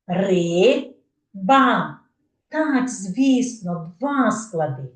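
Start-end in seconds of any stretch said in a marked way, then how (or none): 0.1-2.0 "Риба" split into two beats; syllable by syllable. Shown as a demonstration. Teacher counting syllables, "Риба" said syllable by syllable. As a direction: pause between Ри and ба. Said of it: "скільки всього складів".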